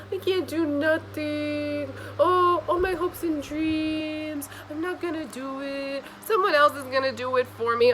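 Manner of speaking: high, slow whiny mock voice; mocking whiny quitter. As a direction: whine like a self-pitying slacker. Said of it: "don't just say".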